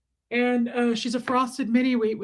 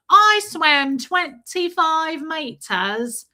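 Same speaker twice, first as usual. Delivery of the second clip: put-on slow, higher English-accented child's voice; little boy's English accent; 5 semitones higher, 5 dB louder, much slower than usual. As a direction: imitate an excited little English boy, drawn out and louder. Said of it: "I've done my English accent".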